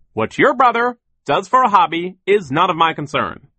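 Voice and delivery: high, loud, dismissive boss; pompous, forceful scolding; overbearing boss. A narrator's reading. Figure of gruff boss Sir.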